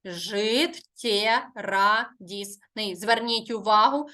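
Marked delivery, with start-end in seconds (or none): 0.1-2.9 "Життєрадісний" slowly, one syllable at a time; measured syllable count of "Життєрадісний". Shown as a demonstration. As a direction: split "Життєрадісний" into slow, separate syllables. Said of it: "рахуємо тепер склади".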